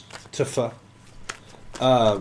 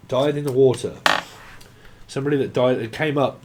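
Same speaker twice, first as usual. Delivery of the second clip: normal talking voice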